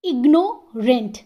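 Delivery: Ignorant slowly split, gap after IGNOU; ignorant in two separated parts; pause mid-word. Shown as a demonstration. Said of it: "यहाँ पे भी टू syllables हैं".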